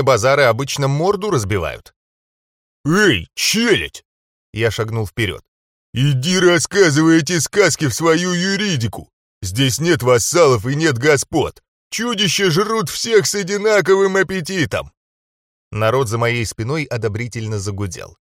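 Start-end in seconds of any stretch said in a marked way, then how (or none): 2.9-4.0 contemptuous call. A narrator's reading. Hero calls out to enemies. 5.9-14.9 defiant retort. A narrator's reading. Hero defies the dark mages.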